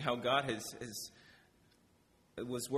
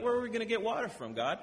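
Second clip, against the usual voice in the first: mock complaint; 10 semitones higher, faster than usual. Israel complains to God.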